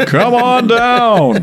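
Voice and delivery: very slow high booming announcer; Don Pardo. Retold or imitated. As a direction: stretch it out like a game show host. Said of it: "Now that's Don Pardo".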